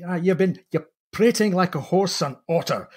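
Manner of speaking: put-on a huffy Scotsman splutters in a Scottish accent. Marshall scolds the purser over spilt gravy, indignant Scottish accent. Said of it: "phoneticizes this Scottish accent ... indignant".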